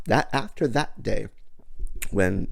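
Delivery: ordinary talk, nothing marked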